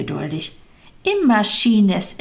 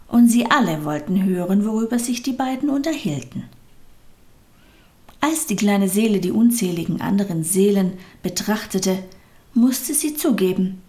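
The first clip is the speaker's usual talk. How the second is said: ordinary delivery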